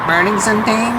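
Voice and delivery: mock him in a sped-up chipmunk squeak. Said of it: "with his artificially pitched up voice".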